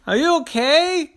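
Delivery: high, loud worried shout. Acted. Terry shouts to his mommy after the crash, high and loud. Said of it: "Terry shouted at his mommy".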